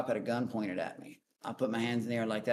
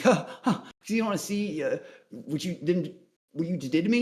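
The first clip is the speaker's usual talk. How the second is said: high, louder taunting comeback; sarcastic jab, higher and louder; mocking retort; 5 semitones higher, 3 dB louder than usual. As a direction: throw it back at the cop with scorn. Mocking the arresting officer.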